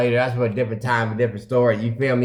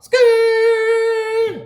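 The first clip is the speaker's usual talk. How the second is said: loud, very high squealing skirt; tire screech; 5 dB louder than usual. Retold or imitated. Taking off from the ATM, loud high tire screech. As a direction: screech off like peeling tires. Car peeling off.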